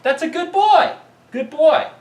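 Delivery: lavish the dog with squeaky praise; excited dog praise. Real, not acted. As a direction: owner rewarding his dog with high-pitched praise. Praising the dog after retrieve.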